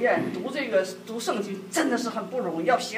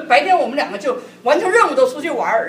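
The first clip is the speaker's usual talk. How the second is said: normal talking voice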